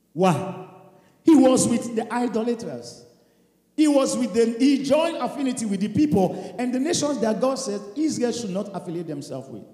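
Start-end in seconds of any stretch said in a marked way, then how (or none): none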